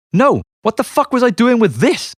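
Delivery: panicked disbelief. Retold or imitated. Accusing his bandmates over the drugs, high and baffled. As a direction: sound baffled and alarmed.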